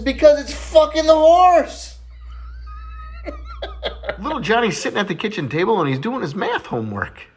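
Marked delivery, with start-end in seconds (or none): 0.0-2.0 put-on high, louder, slow child's blurt; eager kid. Boy tells his mom the answer, high and eager. 2.0-4.1 teller's own cackle at the punchline; high laugh. Real, not acted. Comedian laughs at his own punchline.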